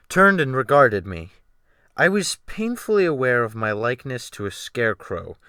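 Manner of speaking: plain reading voice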